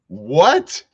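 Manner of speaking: hammed-up birthday excitement; excited surprise. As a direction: make a big show of astonished delight. Reacts to a viewer's birthday news.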